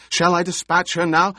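loud, high eager shout. Acted. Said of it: "the Baron shouted".

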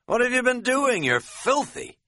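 high-pitched gleeful astonishment; amused, amazed outburst; delighted exclamation. A narrator's reading. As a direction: cry it out high, thrilled by the mess. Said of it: "delighted at my grubbiness".

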